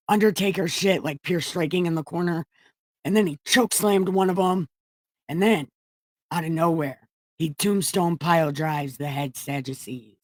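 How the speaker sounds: ordinary talk, nothing marked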